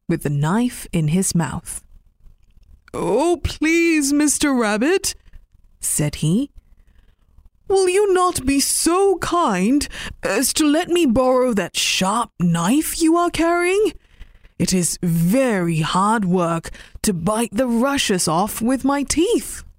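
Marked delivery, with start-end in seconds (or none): 2.9-5.2 high, polite begging; pleading old man. Acted. Old man asking Mister Rabbit for the knife, mildly pleading. 7.7-19.6 high, polite begging; pleading old man. Acted. Old man asking Mister Rabbit for the knife, mildly pleading.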